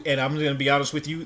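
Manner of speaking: ordinary talk, nothing marked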